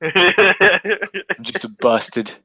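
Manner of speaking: lightly voiced sorry-for-himself bastard; mock self-pity. Co-host mocking Jon Snow, put-on self-pity. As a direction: poke fun at a mopey character.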